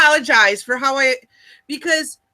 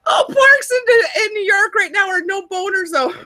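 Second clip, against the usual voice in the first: excited shout; 9 semitones higher than usual. Real, not acted. Shouting joke about park rule.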